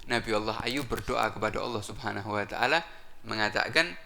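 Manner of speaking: usual speaking voice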